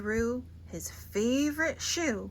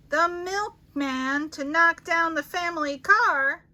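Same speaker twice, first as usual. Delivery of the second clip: building excitement; 6 semitones higher, 6 dB louder than usual. A narrator's reading. Cumulative list builds.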